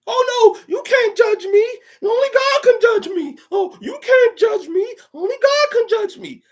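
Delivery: loud falsetto protest; mock indignant sinner. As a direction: wail defensively, very high and loud. Mocks people caught sinning.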